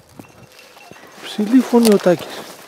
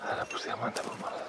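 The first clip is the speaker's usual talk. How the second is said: whisper; 18 dB quieter, much faster than usual. Real, not acted. Muttered aside while hunting.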